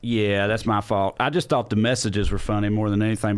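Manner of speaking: ordinary talk, nothing marked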